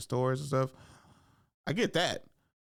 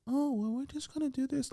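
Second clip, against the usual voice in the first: high, soft put-on voice; mock whispery actress; 13 semitones higher, 4 dB quieter than usual. Comic mimics actress going quiet at random, high soft voice. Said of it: "she just does it, like, in random ass times".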